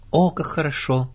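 delighted. A narrator's reading. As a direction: welcome the good news gladly. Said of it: "обрадовалась Кенга".

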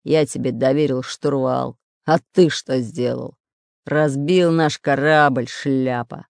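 low, slow reproachful growl; displeased growl. Acted. Pirate blames the spy for the wreck, growling. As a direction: growl the accusation slowly and low. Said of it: "недовольно прорычал Буль-Буль".

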